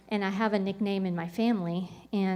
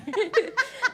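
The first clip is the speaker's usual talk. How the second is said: high laugh; 13 semitones higher, 5 dB louder than usual. Real, not acted. Amused at her own complaint to God, a high laugh.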